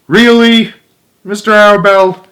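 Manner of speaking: high, loud incredulous gasp of a question; shocked disbelief. Acted. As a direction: sound appalled at what he just said. Reader mocks character's insensitive line.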